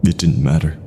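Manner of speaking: usual speaking voice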